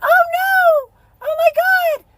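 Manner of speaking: mock dramatic shock. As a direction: squeal it like a fake panic. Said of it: "And you say".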